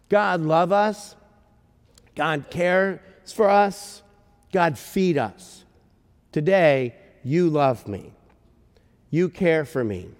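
slow, halting, broken-English sincerity; heartfelt declaration. Retold or imitated. Man's broken-English solemn heartfelt answer.

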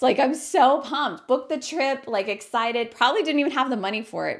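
pumped up, high and loud; excited. Retold or imitated. Traveler reliving booking the trip, pumped up and excited. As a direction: gush with hard emphasis.

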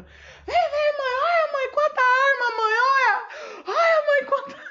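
put-on very high, loud child shout; little girl yelling. Toddler on the roof calling mom about the guns, high and loud. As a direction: squeal like an excited toddler on the roof.

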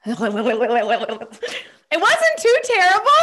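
laughing. Real, not acted. Just took the shot.

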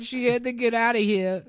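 high put-on comedian voice; Seth Dickfield. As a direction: caricature a comedian breaking the news. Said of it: "this is just a cruel impression".

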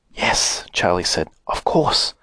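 unvoiced whispered yes. Charlie agreeing with grandpa beside sleepers, whispered. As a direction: agree under your breath.

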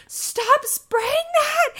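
very high, loud, drawn-out yell; hammed-up strained shriek; exasperated labor scream. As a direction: re-enact screaming an order. Yelling at husband in labor.

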